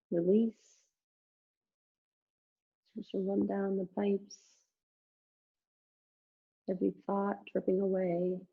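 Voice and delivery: soft, calm relaxation guidance; guided meditation. Acted. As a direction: ease them gently into rest. Leads Shavasana relaxation.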